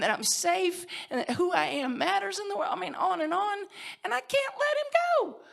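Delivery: choked up and rising; voice breaking. Real, not acted. Emotion rising at the memory.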